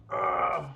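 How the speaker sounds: loud, high groan of adoration; overwhelmed fan's "ugh"; adoring groan. Real, not acted. Streamer gushing over Naomi, loud adoring groan.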